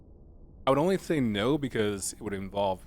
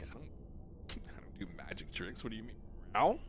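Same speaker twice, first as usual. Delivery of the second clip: hushed, whispery cat playing innocent; coy cat; 2 semitones lower, 10 dB quieter than usual. Cat denying it does magic tricks, whispered innocence. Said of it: "But a cat would be like".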